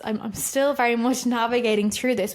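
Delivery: normal talking voice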